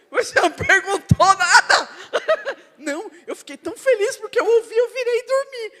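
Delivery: laughing loudly, high-pitched; can barely get words out through laughter. Real, not acted. Preacher laughing hard at his sister's story.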